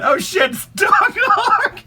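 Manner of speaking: high laughing yelp; laughing shout. Real, not acted. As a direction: crack up and yell it. Laughing exclamation at joke.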